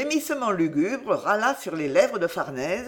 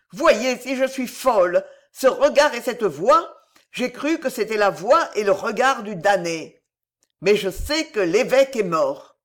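higher, louder feverish voice; madwoman; 4 semitones higher, 5 dB louder than usual. Acted. Madwoman admits she mistook the damned man, agitated. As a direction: explain feverishly, pitched up and loud.

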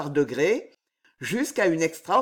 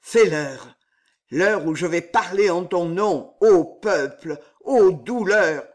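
tragic voice; 3 dB louder than usual. Acted. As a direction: proclaim it like a doomed stage hero. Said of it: "d'une voix tragique".

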